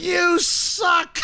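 high-pitched drawn-out jeer; heckling. Retold or imitated. Fans at the cheerleader guy, high heckling call. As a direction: jeer like a fan in the stands. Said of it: "like, heckling the guy".

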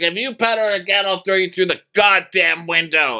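high-pitched imitation of a furious threat; mock outraged yelling; angry threat. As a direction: threaten him in a high, worked-up voice. Retelling Craig's screamed threat.

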